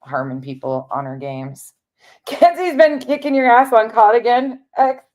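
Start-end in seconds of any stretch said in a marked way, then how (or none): 2.3-5.0 mock teen voice. Teasing kid in mock voice.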